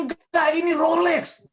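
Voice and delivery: loud, high-pitched mock protest; insistent retort. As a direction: act out a friend loudly insisting. Friend insists the watch is genuine.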